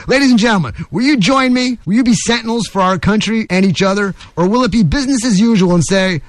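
rally them with rhetorical questions; rousing editorial appeal. Acted. Host asking listeners to be sentinels, rousing appeal.

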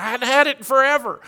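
high-pitched imitation of excitement; acting out someone's thrill; excited. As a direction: gush it in a put-on high voice. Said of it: "She got really excited about it".

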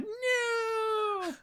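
high, drawn-out little wail; mock childish protest, high and soft; mock kid whine. Kids denied their treat.

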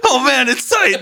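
very high, squeezed whine; strained mock squeal. Kid clenching during a spanking, high strained squeal. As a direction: squeal it as if clenching against a blow.

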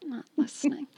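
hushed, whispered jab at the co-host; whispered aside. Pretend. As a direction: drop to a whisper as a mock aside. Aside about co-host, dropped to whisper.

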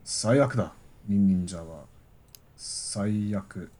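low, soft, resigned Japanese; calm Japanese. Pretend. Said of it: "you were the calmest one".

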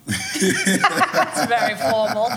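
slow, laughing tease. Real, not acted. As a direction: laugh through it, teasing the guest. Laughs at guest's formal greeting.

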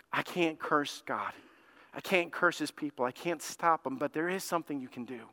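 soft, hushed proposal; confidential scheming. Acted. Balaam offering Balak another way, confidential and soft. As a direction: let him in on a plan quietly.

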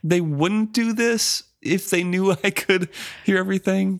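high, slow, amused ship voice; high drawn-out mock musing; Paragon's musing voice. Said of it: "So he's like".

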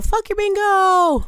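high, loud, drawn-out mock victory shout; demonstrated winner's yell. Shown as a demonstration. Host showing players what winners yell, playful yell. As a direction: yell it out like a bingo winner, stretching the words. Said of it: "and you yell".

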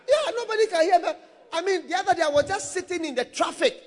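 usual speaking voice

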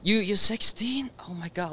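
hushed, high astonished whisper; surprised gasp. Retold or imitated. Dorm friends gasping at the sixteen-year-old, surprised whisper. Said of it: "everybody was like".

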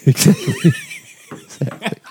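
high, helpless laugh on the words; laughing agreement. Real, not acted. Cohost cracking up at friend's joke about a figure, laughing agreement. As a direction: agree through laughter, the second one fading to breath.

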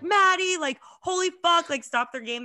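loud, high excited yell of greeting; excited shout. Retold or imitated. Friends spotting her mid-game, excited shout. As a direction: call out her name in happy surprise. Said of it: "And they were like".

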